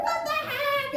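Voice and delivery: very high-pitched comic shriek; hammed-up startled cry; retold scream. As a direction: shriek it in mock alarm. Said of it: "so I'm screaming".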